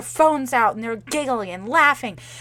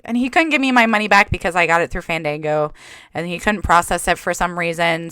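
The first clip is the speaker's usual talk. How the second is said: ordinary delivery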